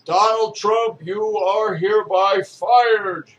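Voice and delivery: loud, slow, high-pitched pronouncement; mock firing proclamation. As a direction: proclaim it grandly, stretching the words. Mock firing of Trump, announcer-like.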